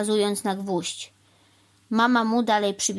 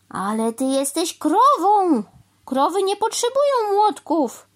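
loud, high insistence, key word stretched; exasperated protest; 10 semitones higher, 6 dB louder, slower than usual. A narrator's reading. Crow telling cow she needs no hammer, exasperated. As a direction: insist loudly, climbing in pitch.

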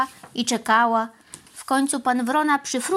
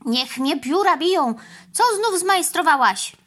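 calling out; 6 semitones higher, 3 dB louder than usual. A narrator's reading. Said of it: "zawołał pan Wrona".

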